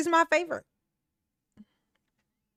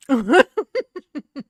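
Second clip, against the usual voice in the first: loud burst of laughter; laughing; 3 semitones higher, 9 dB louder than usual. Real, not acted. Laughing about the spoiled good time, loud laugh.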